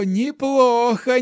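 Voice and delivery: put-on slow, high amused old man; laughing old hermit. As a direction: relish the praise with a chuckle. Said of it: "рассмеялся отшельник Вэй".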